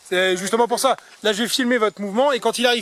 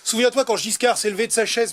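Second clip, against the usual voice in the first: ordinary talk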